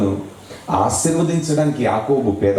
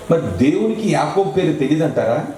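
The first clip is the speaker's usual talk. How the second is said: normal talking voice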